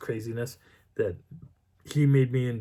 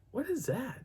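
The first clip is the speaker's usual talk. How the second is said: puzzled surprise; 12 semitones higher, 7 dB quieter than usual. Retold or imitated. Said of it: "I'm like".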